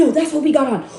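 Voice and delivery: ordinary talk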